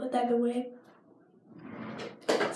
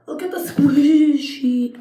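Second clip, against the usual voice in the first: slow, louder insistent order; mock command; 3 semitones higher, 7 dB louder, slower than usual. Ordering viewers to watch the squishy, mock bossy. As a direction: drag it out bossily. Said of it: "I'm like".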